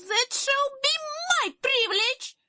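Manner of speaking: very high, loud haughty shout. A narrator's reading. Princess claims the throne over her sisters, very high shout. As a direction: shriek your royal claim over the others. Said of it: "shouted Sapphire".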